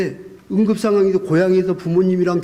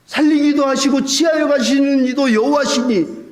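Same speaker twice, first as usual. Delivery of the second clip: wife's rebuke; 9 semitones higher than usual. Retold or imitated. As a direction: scold the husband who forgets he is a pastor. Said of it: "그렇게 얘기하는 거예요".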